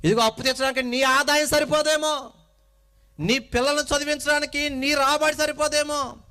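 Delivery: loud, rising sermon build; preaching at full force. Acted. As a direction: hammer each line home to the congregation.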